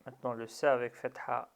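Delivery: ordinary talk, nothing marked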